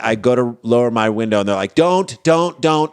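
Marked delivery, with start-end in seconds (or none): 1.7-2.9 higher, insistent mock alarm; urgent warning. Testing staff stopping him lowering the window, urgent warning. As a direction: imitate someone frantically stopping a driver.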